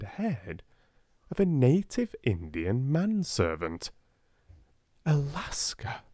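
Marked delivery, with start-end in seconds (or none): 5.1-6.0 a hushed, higher whisper; quick whisper. A narrator's reading. Sarah whispering to herself on seeing the man. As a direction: breathe the word quickly. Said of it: "Sarah said to herself quickly".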